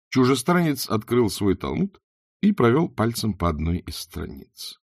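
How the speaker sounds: ordinary delivery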